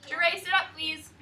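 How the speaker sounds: ordinary talk, nothing marked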